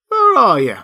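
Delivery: high, loud startled question; startled cry. A narrator's reading. Marvel to the unseen speaker over his shoulder, startled. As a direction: call out in alarm, falling away.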